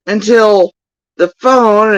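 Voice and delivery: ordinary talk, nothing marked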